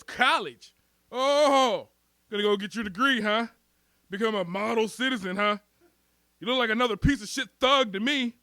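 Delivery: mock-impressed, needling tone; mocking cop. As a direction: jeer at him as a scornful cop. Cop mocking his college plans.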